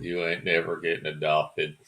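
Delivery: low, soft Southern menace; evil Southern villainess, pitched low; villainous Southern orphanage matron. Acted. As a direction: tell an orphan off in a deep Southern voice. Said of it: "sounds like the woman telling an orphan".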